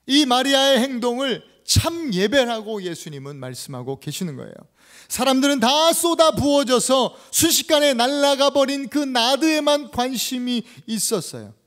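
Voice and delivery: loud, high, emphatic pulpit delivery; forceful preaching. Acted. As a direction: drive the point home loudly. (힘주어 외치는 설교)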